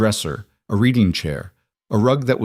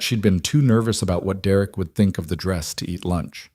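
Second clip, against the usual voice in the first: usual speaking voice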